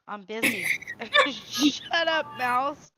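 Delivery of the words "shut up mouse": laughing. Real, not acted. Laughing mock scolding.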